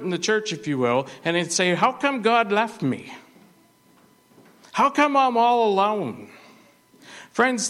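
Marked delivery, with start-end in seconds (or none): 1.7-6.3 self-pitying complainer. Acted. Complaining believer voiced by preacher.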